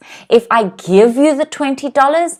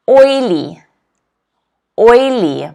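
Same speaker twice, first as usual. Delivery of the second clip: "oily" with OI stressed; teacher's model OIly; stress on first syllable; 4 dB louder, slower than usual. Shown as a demonstration. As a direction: stress OI, short "ly". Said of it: "the apostrophe ... before the first".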